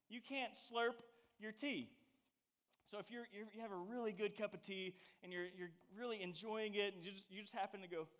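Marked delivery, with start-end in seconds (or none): none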